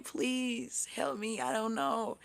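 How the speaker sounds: high, slow, helpless plea; begging for help. Retold or imitated. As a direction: plead slowly like you're lost. Retells own begging.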